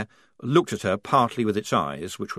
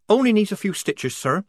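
cheerful; 10 semitones higher, 3 dB louder than usual. Acted. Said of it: "said Polly cheerfully".

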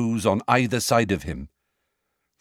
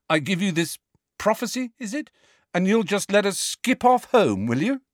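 sarcastic; 11 semitones higher than usual. A narrator's reading. Harry sarcastic.

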